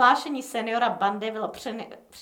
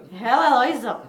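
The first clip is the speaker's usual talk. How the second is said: laughing; 7 dB louder than usual. Acted. Gang banter in a story.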